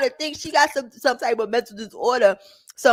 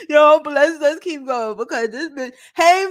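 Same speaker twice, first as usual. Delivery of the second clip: laughing; 4 semitones higher, 4 dB louder than usual. Real, not acted. Still laughing, trying to go on.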